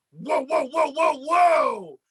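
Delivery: drawn-out, stretched whoas; slow, amazed whoa at a star; excited whoa. Retold or imitated. Puppet excited at Kevin Owens.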